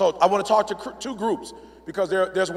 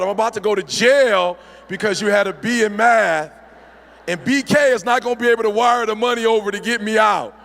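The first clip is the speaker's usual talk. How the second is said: loud, emphatic comic protest; mock outrage at full volume; exasperated dad; 3 semitones higher, 6 dB louder than usual. Dad telling sweetheart he will go to jail, exasperated.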